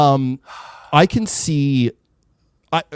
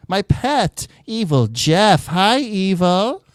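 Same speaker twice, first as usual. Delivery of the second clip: normal talking voice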